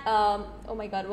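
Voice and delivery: ordinary talk, nothing marked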